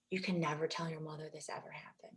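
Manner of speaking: hushed, urgent whisper; soft conspiratorial whisper to the boy; secret whisper. Retold or imitated. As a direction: whisper it like a secret that must stay hidden. Director secretly warns kid before take.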